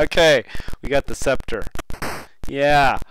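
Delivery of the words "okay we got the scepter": usual speaking voice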